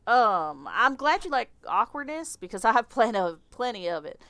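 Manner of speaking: lightly Southern, stumbling over words; Southern accent. Acted. Victor admitting his awkwardness to the clerk, light Southern accent. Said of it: "I don't know why he's Southern".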